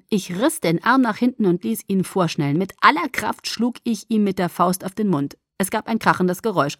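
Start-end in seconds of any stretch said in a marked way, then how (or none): none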